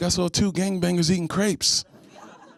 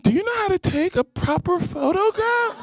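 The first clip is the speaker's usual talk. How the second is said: high prim mock-polite voice, much higher; hammed-up haughty request; mock snooty woman; 13 semitones higher than usual. Woman asking him snootily, high prim mock voice. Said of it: "I'm asking him".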